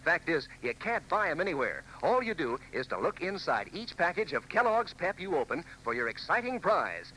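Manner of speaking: ordinary talk, nothing marked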